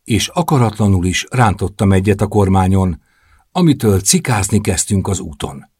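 ordinary delivery, nothing marked

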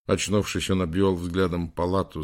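ordinary delivery, nothing marked

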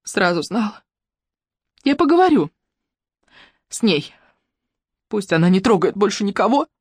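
mildly tearful female voice; halting, understated weepy resolve; sobbing. A narrator's reading. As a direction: speak in broken, sobbing pieces. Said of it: "всхлипнула Матильда".

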